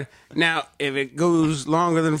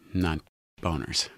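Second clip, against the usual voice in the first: ordinary talk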